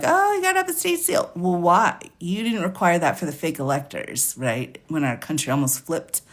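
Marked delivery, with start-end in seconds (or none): 0.0-1.3 high, fast sarcastic mimicry; mocking sarcasm. Mocking Republicans who suddenly demand state seals, high sarcastic mimicry. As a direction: parody the officials, pitched way up and rushed. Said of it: "them being like".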